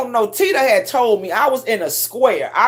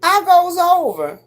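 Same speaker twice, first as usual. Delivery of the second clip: normal talking voice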